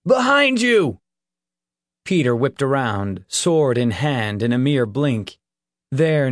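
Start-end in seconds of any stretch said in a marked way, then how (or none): 0.1-1.0 loud high alarmed shout; shouted warning. A narrator's reading. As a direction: yell the warning urgently.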